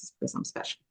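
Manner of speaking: a sly whispered joke to herself; whispered aside. Real, not acted. Telling her cancer story, whispered wry aside. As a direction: drop to a breathy whisper for the quip.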